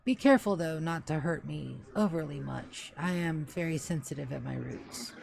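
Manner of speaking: a hint of a low, measured old butler; measured and dignified, softly pleading; stately old butler. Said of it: "think of the voice that's about to say".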